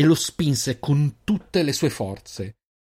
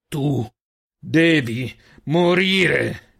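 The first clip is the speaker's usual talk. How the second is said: louder, very slow snarling threat; growled threat; 4 semitones higher, 5 dB louder, much slower than usual. Acted. Wounded hunter snarling at Girin: slow loud growl. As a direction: drag out the death threat like a wounded beast. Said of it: "ringhiando più che parlando".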